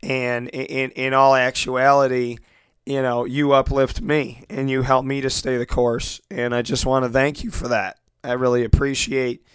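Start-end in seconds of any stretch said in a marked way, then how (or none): none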